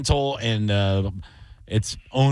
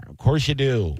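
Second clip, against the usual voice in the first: ordinary delivery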